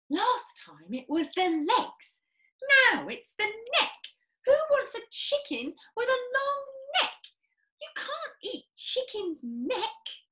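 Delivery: high, half-laughing complaint; father moaning about the long neck, high pitched; disgruntled father. Acted. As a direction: grumble at the failure. Mr Cranky disappointed.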